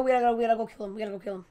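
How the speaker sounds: normal talking voice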